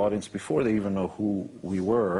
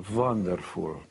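put-on light Hungarian accent with a V for the W; a hint of his Hungarian mother's English; a son imitating his mother's lightly accented praise; slower than usual. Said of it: "she said in her broken English".

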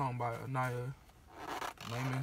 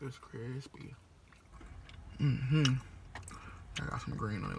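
talking between bites; chewing while talking; 4 dB louder than usual. Real, not acted. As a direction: mouth partly full of food. Seen 4: Closed-lip mm-hmm, food in hand, mouth working between bites.

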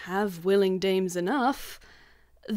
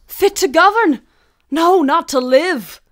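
much higher, much louder furious outcry; outraged shout; 8 semitones higher, 12 dB louder than usual. Acted. Macduff shouts at Malcolm in outraged disbelief. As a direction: explode at the very idea.

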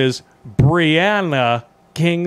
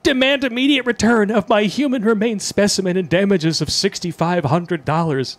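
higher mocking read of quote; 7 semitones higher than usual.

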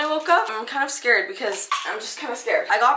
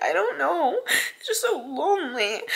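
crying; 5 semitones higher, 3 dB quieter than usual. Real, not acted. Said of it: "a lot of crying in this video".

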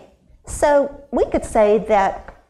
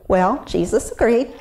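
usual speaking voice

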